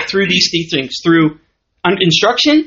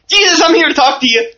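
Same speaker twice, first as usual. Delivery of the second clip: high, excited mom calling to her son; 7 semitones higher than usual. Acted. Mary outside calling to Jesus in the crowd, acted excited call. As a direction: pipe up eagerly, pitched high, as if calling inside. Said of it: "And she's excited".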